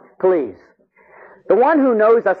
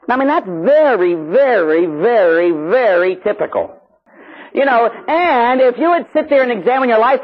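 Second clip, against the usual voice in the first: high, emphatic preacher delivery; impassioned preaching; 8 semitones higher, 4 dB louder than usual. Acted. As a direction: preach it, stretching the key words. Preaches at full force.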